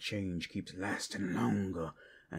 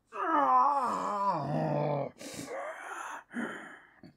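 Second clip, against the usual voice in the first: loud, high, tearful cackle; anguished laugh; 13 semitones higher, 6 dB louder than usual. Acted. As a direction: laugh like it hurts. Mocks the chain holding him.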